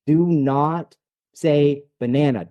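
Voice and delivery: each word set apart, slow and firm; a slow, pointed command; slow firm instruction. Shown as a demonstration. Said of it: "If I tell you".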